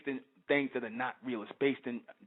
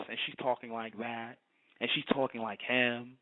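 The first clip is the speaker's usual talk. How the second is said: low, monotone imitation of her; mimicked fake talk; 4 semitones lower than usual. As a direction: drop low and drone it, mocking her. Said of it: "she talking just like this".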